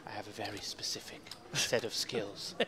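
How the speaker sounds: whispered gravel-free movie threat; Liam Neeson impression. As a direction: whisper it like a menacing action hero. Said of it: "Little bit of the Liam Neeson's".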